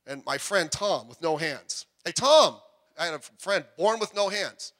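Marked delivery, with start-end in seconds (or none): 2.0-2.6 calling out. Retold or imitated. Calling to friend while hanging.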